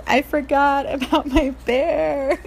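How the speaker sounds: usual speaking voice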